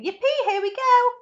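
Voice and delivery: high, loud, thrilled whooping; joyful shout. A narrator's reading. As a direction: whoop as the ride takes off. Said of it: "shouted Grandpa Joe again".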